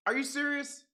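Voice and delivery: high, stunned are you serious; shocked disbelief. Real, not acted. Streamer finds stream was wrong.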